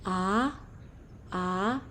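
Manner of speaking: "A" rising like a question; second tone. Shown as a demonstration. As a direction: slide "A" upward as if asking. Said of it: "naik seperti orang bertanya".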